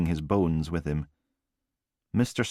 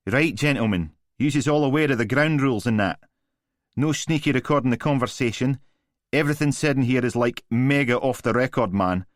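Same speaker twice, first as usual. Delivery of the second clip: louder, higher broad Glaswegian lawyer; 6 semitones higher, 5 dB louder than usual. A narrator's reading. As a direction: chew the words in thick Glaswegian. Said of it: "chewing through a broad Glaswegian accent".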